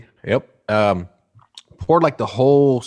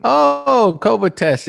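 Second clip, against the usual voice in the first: sudden realization; 14 semitones higher, 4 dB louder, much slower than usual. Real, not acted. Suddenly remembers the test.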